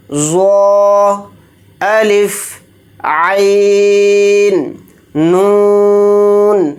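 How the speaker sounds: letter names drawn out slowly one by one; drill recitation. Shown as a demonstration. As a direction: recite each letter slowly for pupils to repeat. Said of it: "جس طرح سے میں نے یہ پڑھا ہے آپ کو بھی میرے ساتھ ریپیٹ کرنا". (بچوں کو حروف دہرانے کے لیے آہستہ پڑھیں)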